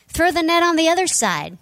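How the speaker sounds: high, loud call to the boat; calling from shore. Retold or imitated. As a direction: call the advice across the water. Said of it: "And the voice from shore".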